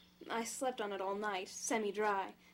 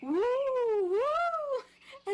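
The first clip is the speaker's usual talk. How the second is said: high, loud playful ghostly wooing; ghost noise; 14 semitones higher, 10 dB louder than usual. Pretend. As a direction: mimic a haunted house moaning for fun. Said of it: "As if it's filled with ghosts".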